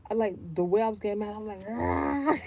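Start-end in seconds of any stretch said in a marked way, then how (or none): 1.7-2.4 high mock exclamation. Retold angry exclamation.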